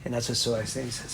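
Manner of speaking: ordinary talk, nothing marked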